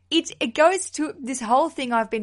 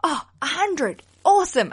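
higher-pitched excited reaction; excited self-quote; 7 semitones higher, 6 dB louder than usual. Retold or imitated. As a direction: re-enact a pleased reaction, lifting the pitch. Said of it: "I was like".

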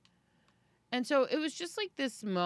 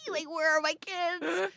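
high, slow, panicked worry; frantic mom realizing the kids are forgotten; mock panic; 10 semitones higher, 4 dB louder, slower than usual. Mother retelling her frantic worry about the kids, mock panic.

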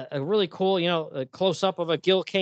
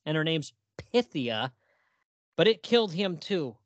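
ordinary talk, nothing marked

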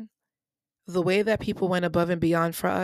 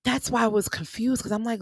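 usual speaking voice

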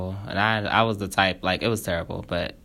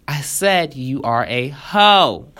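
slow, word-by-word insult, louder; emphatic insult; 3 semitones higher, 5 dB louder, slower than usual. Acted. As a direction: spell it out slowly to make the insult land. Character repeats insult in quarrel.

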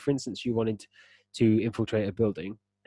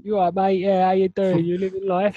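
higher, louder over-the-top Cockney; Camden punk Cockney; 9 semitones higher, 6 dB louder than usual. Retold or imitated. Mocking the game's punk voice in Cockney. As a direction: bark it like a cheeky Camden punk. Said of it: "Punk from Camden".

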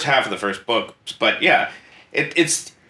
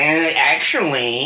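nerdy know-it-all; 3 semitones higher, slower than usual. Retold or imitated. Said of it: "and he's like".

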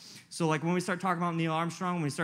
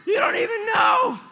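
much higher, much louder joyous yelling; ecstatic shout; 12 semitones higher, 10 dB louder than usual. Retold or imitated. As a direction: yell the news at the startled pastor. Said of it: "I was like".

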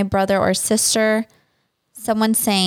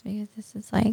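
fast unvoiced whisper; whispered aside; 8 dB quieter, faster than usual. Real, not acted. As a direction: mutter it fast under your breath. Aside while choosing answer.

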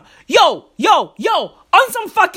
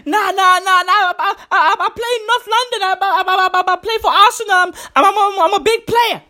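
higher, panicked stutter; stammering Mo Salah; 6 semitones higher, faster than usual. Retold or imitated. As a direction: babble it out in a nervous panic. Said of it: "And Mo Salah was like".

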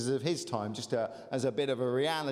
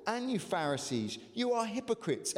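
impassioned scripture reading; 4 semitones higher than usual. Acted. Preaching Jesus' woes.

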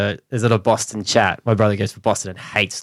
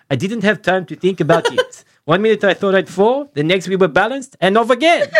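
light mock French accent, pitched higher with a laugh; 8 semitones higher than usual. Host reading the jockey's quote to co-hosts in mock French accent. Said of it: "here comes my French accent".

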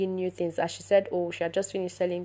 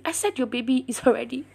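higher, insistent retold repeat; emphatic insistence; 5 semitones higher, faster than usual. Doctor repeating herself to the questioning patient, emphatic insistence. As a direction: press the point, raised in pitch.

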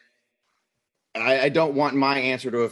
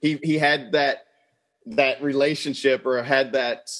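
ordinary delivery

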